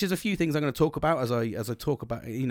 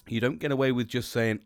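ordinary talk

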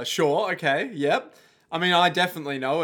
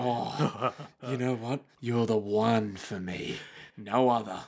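low sinister lover voice; 5 semitones lower, 5 dB quieter than usual. Acted.

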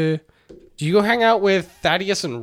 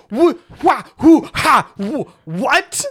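high, loud, broken-off stammer of disbelief; stammering shock; 8 semitones higher, 6 dB louder, slower than usual. Retold or imitated. Devin stammering at the news, high and loud. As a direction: sputter it out, totally blindsided.

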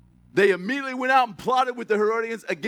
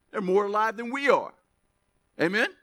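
ordinary talk, nothing marked

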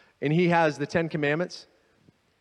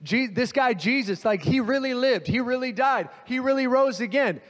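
urgent pleading, pitched higher; 7 semitones higher than usual. Retold or imitated. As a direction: beg the crowd earnestly, please listen. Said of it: "He's begging them".

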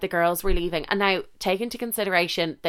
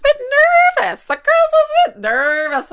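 squeaky nervous; 6 dB louder than usual. Real, not acted. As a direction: voice jumps high and squeaky, admitting nerves.

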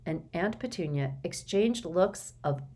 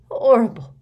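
croaking; 7 semitones higher, 12 dB louder than usual. Acted. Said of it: "croaked Dudley".

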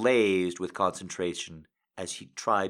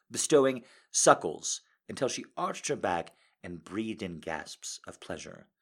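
plain reading voice

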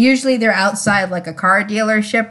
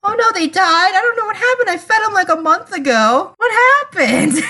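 put-on much higher, whiny little-kid voice; mock clueless kid; 8 semitones higher than usual. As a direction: play a careless child shrugging off the blame. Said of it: "And it's like".

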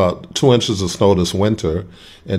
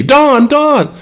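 calling out; 7 dB louder than usual. Retold or imitated. Retelling being called across street.

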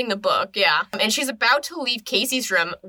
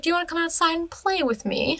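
high, eerie mock-child invitation; creepily sweet high voice; creepy; 9 semitones higher than usual. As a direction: imitate a creepy sister luring someone to play. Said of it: "she's just really creepy".

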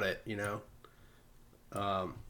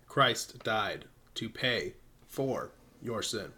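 slow solemn reading; 3 semitones lower, 6 dB quieter, slower than usual. Acted. Reads pamphlet aloud solemnly.

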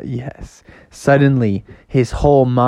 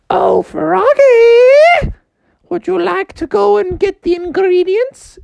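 high sugary mom voice, drawn out; 5 dB louder than usual. Retold or imitated. Mom calling Froggy to fetch ingredients, high and sweet. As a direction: sing out to your son sweetly. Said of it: "she was like".

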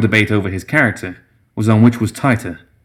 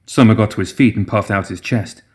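ordinary delivery, nothing marked

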